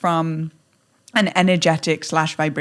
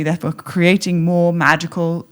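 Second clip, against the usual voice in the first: ordinary talk, nothing marked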